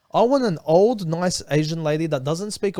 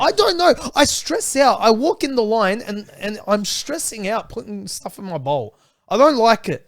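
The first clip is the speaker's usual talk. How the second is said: much higher, louder, exasperated outburst; worked-up rant; 5 semitones higher, 6 dB louder, slower than usual. Real, not acted. Friend explains why Malatang stresses him out, higher and louder exasperated rant. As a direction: blurt it out, stressed and flustered. Ranting about choosing soup ingredients.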